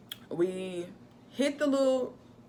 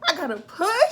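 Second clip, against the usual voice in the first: urgent push; 10 semitones higher, 7 dB louder than usual. Retold or imitated. Reenacting labor.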